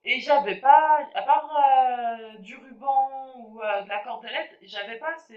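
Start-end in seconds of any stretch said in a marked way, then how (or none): none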